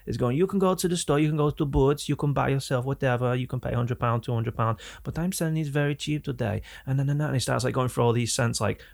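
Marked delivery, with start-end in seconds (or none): 0.4-6.6 sing-song German sales pitch; German accent. Retold or imitated. German salesman selling cheap scents to shop staff, German accent. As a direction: list the options like a German salesman. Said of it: "misrepresentation of the German accent".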